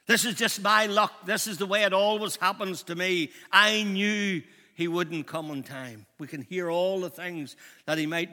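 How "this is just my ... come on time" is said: loud, higher, self-pitying grumble; bitter complaint. Acted. Jairus complaining about his bad luck, bitter and loud. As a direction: whine that it always happens to me.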